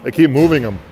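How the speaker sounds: brisk hockey announcer calling the action; live play-by-play. Acted. Announcer calling the hockey game for listeners, in brisk play-by-play. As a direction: call the game as it happens, rising on big plays.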